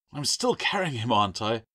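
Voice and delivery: high, loud irritated retort; exasperated snap; snapped. A narrator's reading. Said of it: "Adrian snapped".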